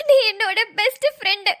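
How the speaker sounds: usual speaking voice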